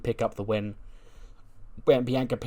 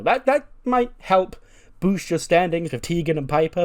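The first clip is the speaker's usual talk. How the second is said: mocking sarcasm; 7 semitones higher, 6 dB louder than usual. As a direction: belittle her with a high mocking lilt. Bayley belittles Bianca.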